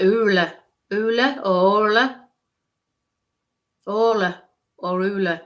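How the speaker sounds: "oola" with a long oo, "orla" with a long or; sounding out a name. Shown as a demonstration. Medium trying out what the word sounds like, sounding it out. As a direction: stretch the first vowel of "oola" and "orla". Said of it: "It sounds like".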